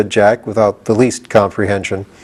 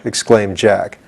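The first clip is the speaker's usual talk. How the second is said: ordinary delivery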